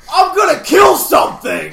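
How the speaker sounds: loud, high mock-furious yell; pissed-off shout. Joking about Rayquaza swooping down, pissed-off shout. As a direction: bellow it as an angry flying monster. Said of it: "flies down all pissed off".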